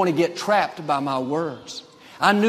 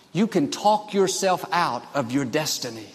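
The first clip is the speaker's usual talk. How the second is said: ordinary delivery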